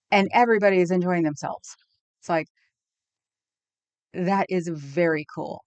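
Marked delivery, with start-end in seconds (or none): none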